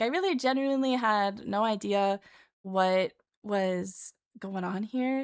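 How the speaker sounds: normal talking voice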